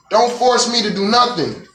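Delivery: normal talking voice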